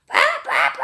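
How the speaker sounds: a sudden loud bang that startles the birds. Acted. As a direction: bark out the bangs like a shot.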